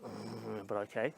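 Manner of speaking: low, soft hesitant mumble to oneself; reluctant inner agreement. Retold or imitated. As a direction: give in reluctantly, very softly. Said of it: "So I thought".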